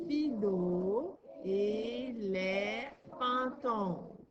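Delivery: «Fido et les fantômes» stretched very slowly, syllable by syllable; teacher reading a title slowly to a pupil; slow sounding out. Shown as a demonstration. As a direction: read the title slowly so a child can follow each syllable. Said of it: "on lit le titre".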